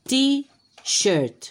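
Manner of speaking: t-shirt said high and bright, stretched for copying; emphatic model word. Shown as a demonstration. Teacher models t-shirt for children, high and emphatic. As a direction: sing out t-shirt so kids can copy. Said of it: "Otra vez".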